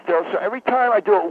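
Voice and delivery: usual speaking voice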